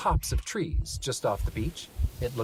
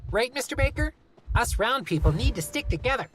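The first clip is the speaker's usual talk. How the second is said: put-on child voice; 11 semitones higher than usual. Talia child voice.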